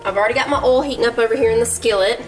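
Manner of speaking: normal talking voice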